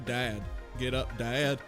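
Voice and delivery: ordinary delivery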